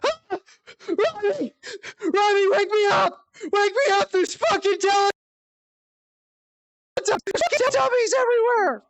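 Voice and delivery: high, loud imitated panic scream; panicked screaming. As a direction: shriek like mom trapped in a nightmare. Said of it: "I suddenly hear her screaming".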